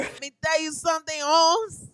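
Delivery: mock Chicano tough guy saying Holmes; Mexican accent. Imitating Mexican friend.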